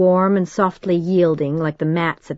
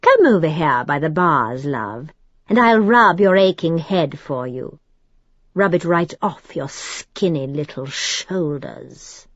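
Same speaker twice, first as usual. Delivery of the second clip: hateful soothing. A narrator's reading. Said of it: "Hatred nearly dripped from his soothing voice".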